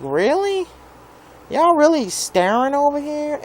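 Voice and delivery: high, incredulous sarcastic question; mock disbelief. To the staring neighbours, mock high disbelief.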